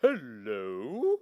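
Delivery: a fussy official llama saying hello; officious llama voice. Acted. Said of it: "adopts the voice of an officious llama".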